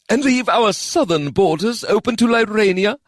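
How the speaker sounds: mild scornful question; scoffing. Acted. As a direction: brush the idea off with scorn. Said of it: "Sajay scoffed".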